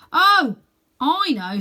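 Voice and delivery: a loud high "oh" of realisation; sudden realisation. A narrator's reading. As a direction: burst out with the answer. Boy realises.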